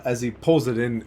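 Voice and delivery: ordinary talk, nothing marked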